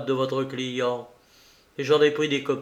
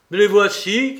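higher, louder exclamation; cri; 7 semitones higher, 7 dB louder than usual. A narrator's reading. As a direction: call it out to an opponent, raised and loud. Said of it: "cria-t-il à Obenreizer". (s'écrier d'une voix forte)

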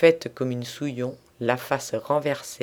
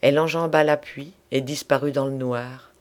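ordinary delivery

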